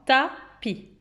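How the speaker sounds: "tapis" in two detached syllables; teacher's separated "ta-pis". Shown as a demonstration. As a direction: say "ta", pause, then "pi". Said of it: "combien il y a de syllabes".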